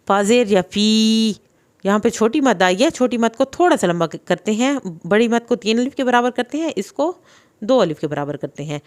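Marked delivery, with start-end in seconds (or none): none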